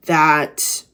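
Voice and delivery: normal talking voice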